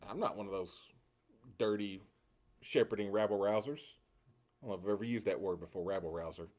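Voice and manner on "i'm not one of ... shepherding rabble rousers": low, muttered disdain with pauses. Acted. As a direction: say it soft and low, picking out each scornful word.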